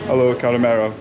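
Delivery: ordinary talk, nothing marked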